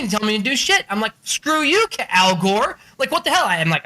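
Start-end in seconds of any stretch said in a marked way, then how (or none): 1.2-3.5 mock outrage. Acted outrage at Al Gore.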